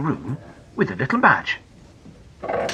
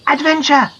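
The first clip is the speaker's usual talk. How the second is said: much higher, loud shout; high girl's shout; yelled back; 11 semitones higher, 5 dB louder than usual. Acted. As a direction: yell back the password. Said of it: "yelled back Janet".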